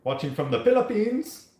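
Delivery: put-on high, playful Filipino accent. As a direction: read it in a joking Filipino accent. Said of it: "Was that your Filipino accent?".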